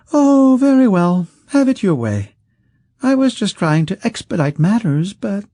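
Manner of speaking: high, loud, theatrical sigh of giving in; mock surrender. Rich man faking surrender to Kesk, high and theatrical. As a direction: pretend to yield while reaching for the spell.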